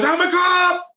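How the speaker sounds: high, startled protest; protesting cab driver. Retold or imitated. Undercover cab driver yanked through the window, high startled cry. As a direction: blurt it out as he's dragged from the cab.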